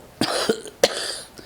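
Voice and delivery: dying, feeble cough; weak wet cough. Acted. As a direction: cough it out weakly. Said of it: "She coughed".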